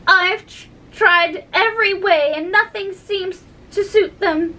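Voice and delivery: high, plaintive complaint; mildly tearful, pitched high; kind of sob. A narrator's reading. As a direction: lament it with a touch of a sob. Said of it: "a kind of sob".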